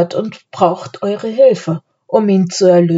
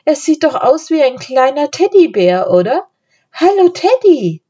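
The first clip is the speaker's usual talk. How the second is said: put-on high-pitched, delighted child; excited little-girl cooing; enthusiastic; 9 semitones higher, 3 dB louder than usual. A narrator's reading. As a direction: gush over a cute puppy, ending in a high greeting. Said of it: "rief Anne begeistert".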